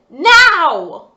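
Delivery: high, loud angry now; a furious one-word order; father's sharp, loud demand; demanding shout. Acted. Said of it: "Father demanded".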